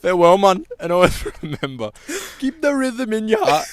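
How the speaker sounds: loud, hammed-up Caribbean farewell; Caribbean accent. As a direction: sign off in a mock Caribbean accent. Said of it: "in a Caribbean accent".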